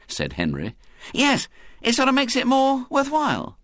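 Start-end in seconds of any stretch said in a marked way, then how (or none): 1.1-3.6 put-on much higher boyish voice; an eager boy piping up; Ginger. A narrator's reading. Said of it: "said Ginger".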